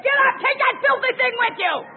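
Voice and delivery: high, loud preaching shout; shouted command. Acted. Preacher voices Jesus ordering the man up, shouting. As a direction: throw the order at him.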